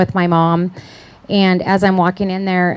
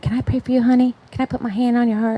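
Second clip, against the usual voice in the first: higher, soft imitation of a church lady; lightly voiced kindly woman; 5 semitones higher, 4 dB quieter than usual. Church woman asking to pray, lightly imitated kindness. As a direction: offer it gently, a hint of honeyed sweetness.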